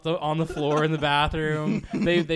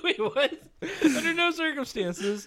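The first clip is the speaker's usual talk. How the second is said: shocked passenger; 13 semitones higher, slower than usual. Retold or imitated. Passengers voiced in mock quarrel.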